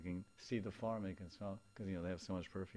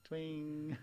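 playful twing; 8 semitones higher, 4 dB louder than usual. Shown as a demonstration. Twang sound effect per sense.

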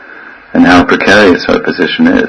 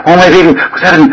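quick, higher-pitched mock panic; panicked mother; 8 semitones higher, faster than usual. Mother running to crying baby, mock panic. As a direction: rush it out like a mother running to her child. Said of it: "And she runs".

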